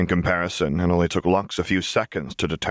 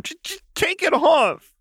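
sobbing cry. A narrator's reading. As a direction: cry out begging. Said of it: "Jinx sobbed".

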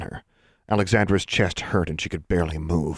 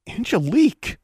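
surprised. Acted. Recognizing rescuer.

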